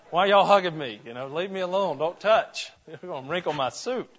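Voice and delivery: loud, high mock complaint against the hugs; mock indignant protest. Preacher pretending to shrug off Georgia hugs, mock indignant. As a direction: play the offended stiff visitor pulling away.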